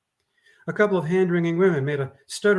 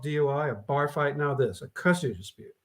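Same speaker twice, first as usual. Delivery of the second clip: usual speaking voice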